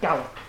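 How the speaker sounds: "gallop" with the stress on GAL, lop unstressed; stress on first syllable. Shown as a demonstration. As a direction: lean on the first syllable of "gallop", let the second drop. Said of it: "stress is on the first syllable".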